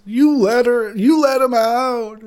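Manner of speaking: loud, high hammed-up accusation; mock accusing. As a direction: wail the blame at them. Mimicking people who blame Loomis.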